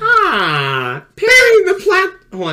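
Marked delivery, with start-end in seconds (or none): 1.2-2.1 very high loud cry of Perry; Doofenshmirtz. Retold or imitated. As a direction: shriek the name like a foiled villain. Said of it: "Doofenshmirtz impression".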